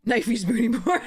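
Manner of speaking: laughing. Real, not acted. Reads a silly card name.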